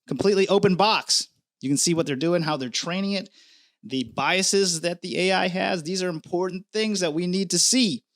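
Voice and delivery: ordinary talk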